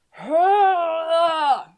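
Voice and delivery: loud high cheer; hurrah. A narrator's reading. Arthur cheering as his body softens, loud cheer. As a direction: cheer it out as your body bends. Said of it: "said Arthur".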